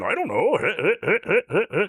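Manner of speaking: slow, drawn out with a laugh in it; Seth Rogen impression. Shown as a demonstration. Doing Seth Rogen for the hosts, slow and laughing. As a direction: stretch it out, chuckling. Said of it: "it becomes Seth Rogen".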